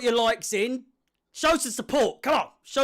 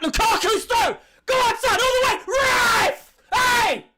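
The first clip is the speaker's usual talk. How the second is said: goal celebration; 8 dB louder than usual. Real, not acted.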